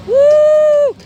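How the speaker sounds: high, loud whooping hoot; long, high excited woo. Retold or imitated. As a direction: whoop like a cheering crowd. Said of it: "went, woo!".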